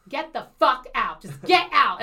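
yelling in a blackout rage; enraged. Retold or imitated. Woman throwing out the man who lied to her, enraged shout. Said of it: "I was blackout with rage".

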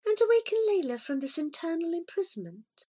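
high, soft and quick; a small cat hoping aloud; cat's wistful thought. Acted. Cat Mascori dreaming of waking Layla, high and soft. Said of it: "thought Mascori".

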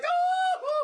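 very high, loud shocked cry; startled yelp. Acted. Moviegoers startled at the concession stand, high yelp. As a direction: yelp it in a sudden fright.

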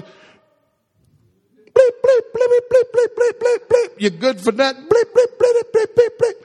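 high squeaky bleep sounds in place of curses; comic high-pitched bleeping; mock cursing. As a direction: squeak out censored swear words. Mock cursing insult to brother.

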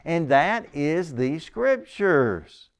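"the Scriptures" drawn out very slowly; emphatic stress. Real, not acted. Preacher naming the one thing to believe, emphatic. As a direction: lean hard and slow on "Scriptures". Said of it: "I'm emphasizing that a lot".

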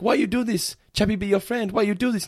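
put-on high, pleading childlike robot voice; imitating Chappie pleading while crying; crying robot kid. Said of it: "he's, like, crying".

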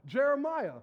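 ordinary delivery

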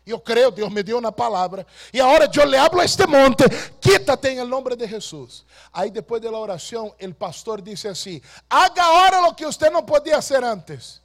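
loud, high, driving sermon voice; fiery pulpit delivery; preaching at full force. Acted. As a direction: preach it out over the whole hall. Preacher builds to a loud pitch.

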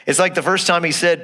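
ordinary delivery

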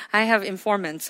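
chuckling over the words; laughing. Real, not acted. Pastor jokes to congregation, chuckling. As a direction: laugh through the joke.

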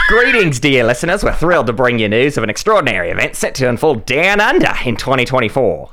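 hammed-up Australian accent, lightly done; Muppet Australian accent. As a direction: read the announcement in a silly Muppet-style Australian accent. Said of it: "this is my Muppet Australian".

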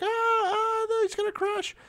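high-pitched mock shriek of alarm; mock crowd panic. Audience at an air show watching a plane fall, hammed-up panic. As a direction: squeal it like a panicking spectator. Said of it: "the whole audience is freaking out".

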